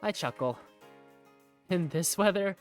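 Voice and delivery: normal talking voice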